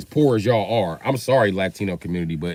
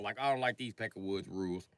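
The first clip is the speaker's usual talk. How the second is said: ordinary talk, nothing marked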